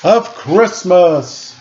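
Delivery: slow, high, ringing proclamation; called out. A narrator's reading. Santa proclaiming to the cheering elves, slow and ringing. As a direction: draw it out high like a herald. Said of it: "and called out".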